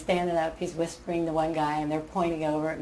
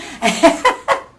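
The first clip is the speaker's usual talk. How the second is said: loud laugh; 10 semitones higher, 10 dB louder than usual. Real, not acted. Laughing at her own story.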